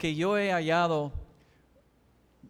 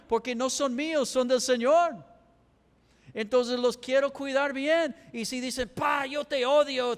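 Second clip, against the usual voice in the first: preaching shout; 6 semitones higher than usual. Real, not acted. Pastor to the congregation, preaching at full force. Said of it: "¿Por qué estoy gritando?".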